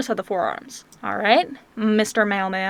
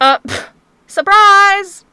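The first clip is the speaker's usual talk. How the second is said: mock surprise; 7 semitones higher, 6 dB louder, slower than usual. Real, not acted. Reacting to a surprise picture.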